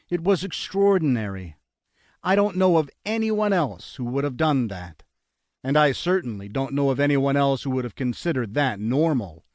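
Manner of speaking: usual speaking voice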